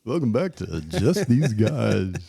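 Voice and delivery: slow, smooth lounge lizard intro. Acted. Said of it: "lounge suit lizard voice".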